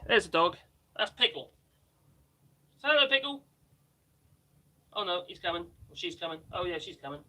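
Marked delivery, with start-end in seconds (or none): none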